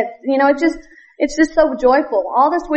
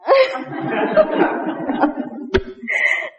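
a long, airy, high laugh; breathy laugh; 10 semitones higher, 4 dB quieter than usual. Real, not acted. Woman laughing at her reluctance to go to Poland. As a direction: laugh at herself, breath running out.

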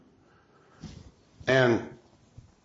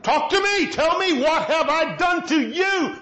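God begging his people to talk to him, pleading; 8 semitones higher than usual. Retold or imitated. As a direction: plead with them, as a hurt God. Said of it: "That's what he's saying".

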